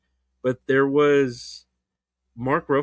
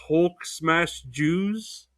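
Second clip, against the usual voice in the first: drawn out very slowly, rising at the end; slow indignant quote; 4 semitones higher, much slower than usual. Retold or imitated. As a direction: lay out the tweet slowly in disbelief. Indignantly requoting the tweet.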